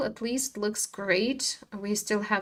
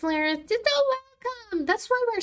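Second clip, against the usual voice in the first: sugary thanks; 13 semitones higher than usual. Real, not acted. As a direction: coo it sweetly at the chat. Streamer answers chat sweetly.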